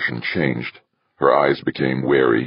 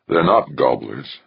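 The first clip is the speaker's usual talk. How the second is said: plain reading voice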